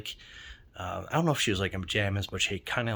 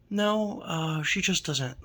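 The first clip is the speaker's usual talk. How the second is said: lightly voiced child, higher pitch; a hint of a little girl, pitched up; young girl; 9 semitones higher, slower than usual. Girl answering the delivery driver about her mom, lightly voiced higher. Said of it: "this girl was probably about ten".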